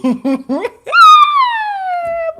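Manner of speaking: high shriek of laughter. Real, not acted. Admitting sarcasm to viewers, high laugh.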